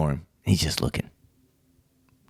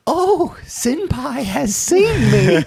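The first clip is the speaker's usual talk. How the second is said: high, slow, starstruck squeal; swooning fan voice; much slower than usual. Pretend. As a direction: gush like a lovestruck anime fan. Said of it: "that you think".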